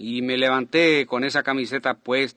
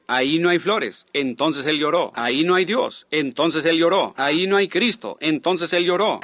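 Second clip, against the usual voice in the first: preaching; 3 semitones higher than usual. Retold or imitated. Re-enacting his sermon's building refrain.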